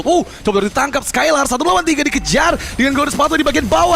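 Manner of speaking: high, loud, fast hype call; excited play-by-play. Real, not acted. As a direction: shout the chase as it happens. Caster calls a chase.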